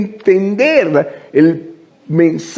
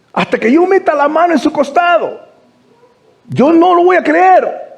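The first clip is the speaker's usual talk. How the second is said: much higher, driving sermon voice; impassioned preaching; 8 semitones higher than usual. Acted. Pastor presses the congregation on doubt, fervent preaching. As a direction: preach it with rising fervor. Preacher rises to full force.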